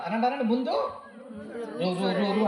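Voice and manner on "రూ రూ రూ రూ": mock stutter. Preacher joking with the congregation, mock stammering the word route. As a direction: repeat the first syllable in a stuck stammer.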